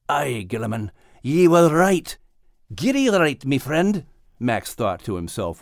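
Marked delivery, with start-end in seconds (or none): 0.1-4.1 lightly Scottish dog, fond and grateful; a hint of a cheerful Scottish brogue; Max's Scottish voice. Max answers his absent friend.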